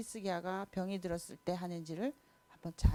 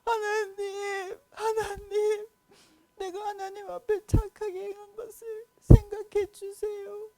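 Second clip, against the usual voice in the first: high, loud, sobbing plea; king sobbing a prayer to God; crying prayer; 12 semitones higher, 7 dB louder, slower than usual. Acted. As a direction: weep and beg God for more life. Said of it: "막 울었어요".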